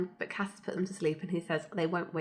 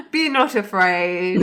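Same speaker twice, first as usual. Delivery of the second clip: mock solemn angel; 10 dB louder, slower than usual. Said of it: "rephrase joke, laughter".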